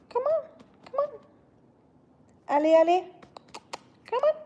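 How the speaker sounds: high sing-song coaxing voice to a pet; sweet high pet-calling voice, drawing the cat near. Real, not acted.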